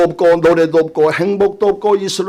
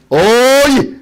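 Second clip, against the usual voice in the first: Chungcheong dialect; 6 semitones higher, much slower than usual. Shown as a demonstration. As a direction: stretch out the Chungcheong hail, raising the pitch. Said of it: "그 충청도 사투리예요".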